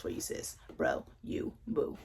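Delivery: whispered aside to the viewers; teasing whispered aside. Real, not acted. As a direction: drop to a breathy whisper.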